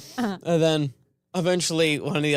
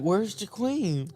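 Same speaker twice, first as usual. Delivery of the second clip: put-on confused kid; 4 semitones higher, much slower than usual. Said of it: "the other kid's like".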